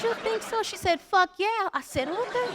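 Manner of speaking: high, innocent coy replies; high squeaky mock innocence. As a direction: play sweet and scheming.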